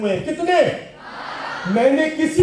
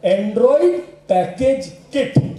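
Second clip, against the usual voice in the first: slow spelled out; much slower than usual. Shown as a demonstration. Teacher to students, each word slowly drawn out. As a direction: slowly spell out Android package kit word by word. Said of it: "इसका पूरा नाम है बच्चों".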